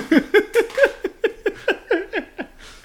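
loud high-pitched cackle; high burst of laughter. Real, not acted. Big laugh mid sign-off.

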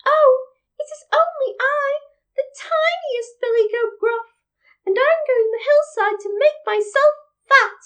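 high, tiny, timid little goat; small goat voice. A narrator's reading. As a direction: squeak it innocently, very high. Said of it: "with such a small voice".

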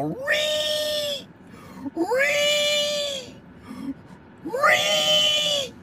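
high, strained mock ree screech. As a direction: screech the ree like a frog meme. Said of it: "they're definitely reeing right now".

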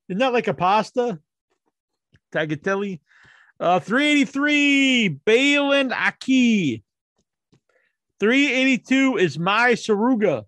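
ordinary delivery

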